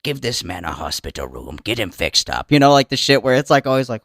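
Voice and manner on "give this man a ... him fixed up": very low, soft order; deep elder command. Acted. Elder ordering care for the broken man, very low. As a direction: command it like a grave overlord.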